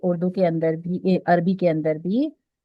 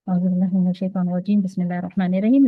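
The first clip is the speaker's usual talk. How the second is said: quick recitation; 4 dB louder, faster than usual. Real, not acted. Opens the Quran lesson with recitation.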